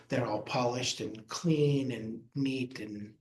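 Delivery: ordinary talk, nothing marked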